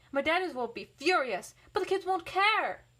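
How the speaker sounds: plain reading voice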